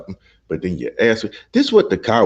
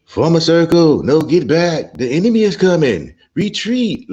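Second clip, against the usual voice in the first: high shouted panicky orders, louder; mock march leader; 9 semitones higher, 5 dB louder than usual.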